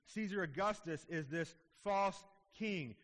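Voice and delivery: usual speaking voice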